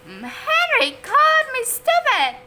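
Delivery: high, loud tattling shriek. A narrator's reading. Little brother shrieking to Mom about Henry. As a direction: shriek it to get Mom's attention. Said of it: "shrieked Peter".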